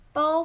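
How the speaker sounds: 包 held high and level; high, flat tone on 包. Shown as a demonstration. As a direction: keep 包 high without moving. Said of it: "flat tone".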